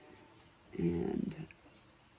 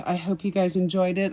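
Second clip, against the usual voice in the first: usual speaking voice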